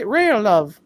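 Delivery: "rail, love" sung out high in a British lady voice; British lady accent. Retold or imitated. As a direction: lilt "rail, love" like a sweet British stewardess. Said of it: "the British lady says".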